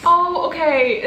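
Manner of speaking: ordinary delivery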